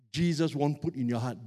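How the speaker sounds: ordinary talk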